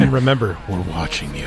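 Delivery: mock menace. Co-host adds a mock menace.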